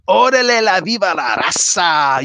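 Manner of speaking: loud, put-on Chicano wrestler voice; Eddie Guerrero impression. Doing a wrestler catchphrase for chat, put-on accent. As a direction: hype it like a wrestler's entrance. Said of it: "Eddie Guerrero".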